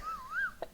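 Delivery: quick high giggle; real little giggle; short high laugh. Real, not acted. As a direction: let a giggle escape. Laughs at being the only ones.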